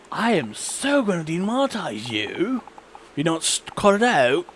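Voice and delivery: high, swooping mock threat; mock scolding YouTube. YouTube threatening the swearing streamer with demonetizing, mock scolding.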